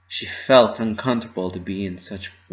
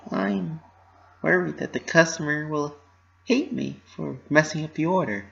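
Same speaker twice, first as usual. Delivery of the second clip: worried confession; 7 semitones higher than usual. Acted. Pinky confessing her worry.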